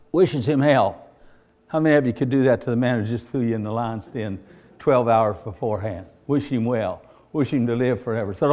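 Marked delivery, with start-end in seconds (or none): none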